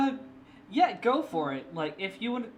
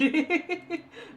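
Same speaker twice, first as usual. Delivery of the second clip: quick, high, louder giggle; 12 semitones higher, 5 dB louder than usual. Real, not acted. Streamer thanking a viewer who waited, giggling. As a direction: break into a short bright giggle.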